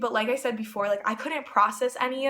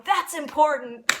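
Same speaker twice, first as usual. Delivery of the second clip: emphatic reply; 4 semitones higher, 6 dB louder, slower than usual. Retold or imitated. Retelling own emphatic reply.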